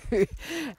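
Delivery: a quick light laugh; short laugh. Real, not acted. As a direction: chuckle at your own joke. Laughing at own joke.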